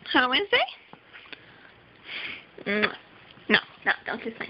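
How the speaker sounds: ordinary talk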